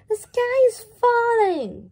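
high, loud frightened chick voice; panicked cry. Acted. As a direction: shriek the news in terror. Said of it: "cried Chicken Licken".

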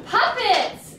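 ordinary delivery, nothing marked

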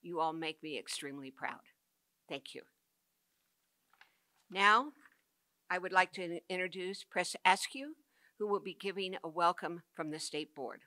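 normal talking voice

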